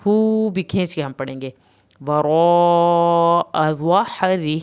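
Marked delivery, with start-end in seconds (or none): 2.1-4.6 "وراء" held three beats long, louder; slow recitation; obligatory madd. Shown as a demonstration. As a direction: stretch the raa of "وراء" three counts. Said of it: "مد واجب کو تین الف کے برابر لمبا کرنا ہے".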